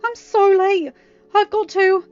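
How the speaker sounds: high, loud and rushed; panicked. A narrator's reading. Mal panicking to friends about being late, high loud fluster. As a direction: fret out loud, cut off mid-sentence.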